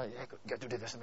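soft, hushed tempter's voice; whispering lizard. Retold or imitated. Lust lizard whispering temptation into the man's ear. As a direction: murmur it like a lizard at his ear. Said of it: "the thing is just whispering to him".

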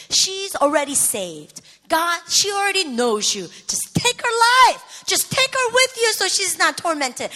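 building to a loud, high cry to God; desperate plea. Retold or imitated. Daughter begging God to end mother's torment, building to a shout.

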